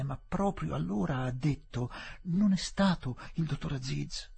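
put-on earnest young woman recalling. Voices Adela recalling the words.

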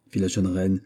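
usual speaking voice

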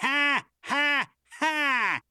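high, very slow stage laugh; mocking laugh. A narrator's reading. Chief laughs at would-be pilot, high drawn-out mocking laugh. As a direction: space out three high laughs. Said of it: "снова рассмеялся шеф".

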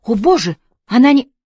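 high, loud gasped exclamation; alarmed cry, pitch leaping; horrified. A narrator's reading. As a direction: blurt it in shock and break off. Shock at friend's likely death.

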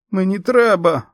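strained rasp from a woman in labour; hoarse strained croak. A narrator's reading. Tiffany in labour croaks to Erin, hoarse strain. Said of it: "прохрипіла Тіфані".

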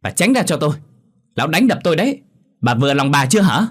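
high, heated accusation; acted bitter daughter; harsh reproach. A narrator's reading. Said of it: "quay sang nhìn bà Nga mà gằn hắt".

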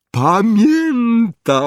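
high, loud, drawn-out exclamation; long soaring shout of joy; delighted cry. A narrator's reading. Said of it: "krzyknął Dedalus Diggle".

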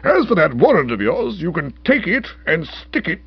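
high, loud pompous indignation; outraged rebuke. A narrator's reading. Uncle warning the officer to be careful, outraged. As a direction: lay down the law indignantly.

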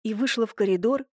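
usual speaking voice

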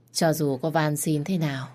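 ordinary delivery

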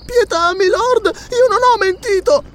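put-on very high, slow pleading child; terrified plea. A narrator's reading. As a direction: beg for mercy as a frightened little girl. Child begs the count for mercy.

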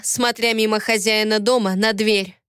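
plain reading voice

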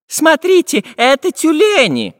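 high, loud geographer pointing out a sight; excited geographer. A narrator's reading. Geographer showing seals to the travellers, high and excited. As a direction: call out the find, high and eager.

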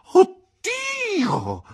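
very high, loud shriek of shock; shocked cry. Acted. Aunt learning the boy is her nephew, shrieking in shock. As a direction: let out a piercing cry of alarm. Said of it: "gridò mia zia".

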